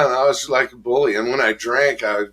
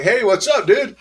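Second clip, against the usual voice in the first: higher, louder tough greeting; mock challenge; 12 semitones higher, 4 dB louder than usual. Young tough stares down another man, higher and louder. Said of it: "And so I'd be like".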